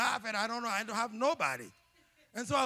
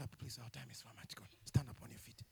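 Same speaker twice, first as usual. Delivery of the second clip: whisper; 10 semitones lower, 9 dB quieter than usual. Real, not acted. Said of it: "unvoiced whisper".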